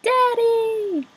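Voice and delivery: put-on high, loud child's shout of joy; excited child. A narrator's reading. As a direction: squeal it as a thrilled kid. Child greets returning father.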